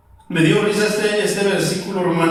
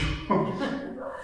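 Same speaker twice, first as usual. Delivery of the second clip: short soft breathy chuckle; a quiet self-mocking laugh; 6 semitones higher, 10 dB quieter than usual. Real, not acted. Laughing at his own stinginess.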